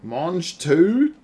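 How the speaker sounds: Mange tout? in loud disbelief; incredulous. Real, not acted. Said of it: "you gotta be fucking kidding me".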